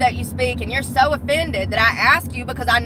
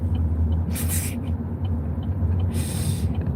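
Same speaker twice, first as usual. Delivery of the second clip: long breathy laugh; drawn-out, mostly voiceless laughing; 4 dB quieter than usual. Real, not acted. Joking about the mental hospital, long laugh.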